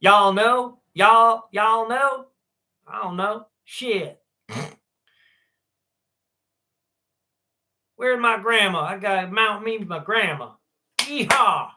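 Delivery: high, loud hammed-up Southern drawl; Southern accent. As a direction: play a country yokel for laughs. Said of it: "can I do a Southern accent?".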